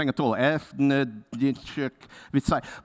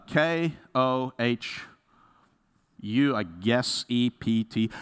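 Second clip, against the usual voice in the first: slow letter-by-letter spelling; 3 semitones lower, much slower than usual. Retold or imitated. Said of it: "And they're like, uh".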